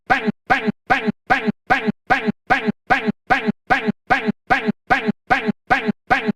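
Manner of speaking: high rapid bangs; machine gun noises. Retold or imitated. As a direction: rattle off gunfire. Rapid gunfire imitation.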